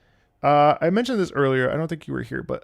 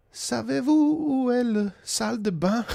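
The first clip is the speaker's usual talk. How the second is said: timid French question; 9 semitones higher than usual. Retold or imitated. Streamer recalling asking for a bathroom in France, high and hesitant. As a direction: ask it high and haltingly.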